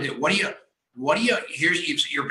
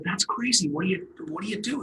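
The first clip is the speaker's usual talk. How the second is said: higher-pitched, mildly incredulous question; amazed retelling; 6 semitones higher, 4 dB quieter than usual. Retold or imitated. Host retelling his chat with a stranger at a coffee shop, amazed. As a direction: retell your own amazed reaction, pitched up.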